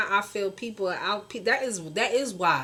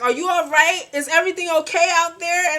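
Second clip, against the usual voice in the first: higher, louder anxious mama voice; worried mom; 10 semitones higher, 8 dB louder than usual. Retold or imitated. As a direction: fuss over your kid on the phone. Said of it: "and she's like".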